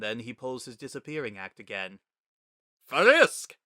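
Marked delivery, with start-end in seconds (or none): 2.9-3.6 much higher, loud delighted cry; Papyrus exclaiming. A narrator's reading. Papyrus greeting Frisk in the rain, booming happy shout. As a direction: call out the name with booming joy. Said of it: "Papyrus exclaims".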